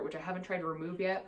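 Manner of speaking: normal talking voice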